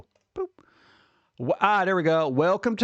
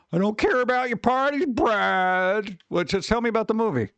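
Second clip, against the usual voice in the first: mock whiny complaint; 7 semitones higher than usual. Ranting at the article.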